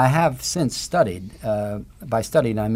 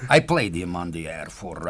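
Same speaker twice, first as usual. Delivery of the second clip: ordinary talk, nothing marked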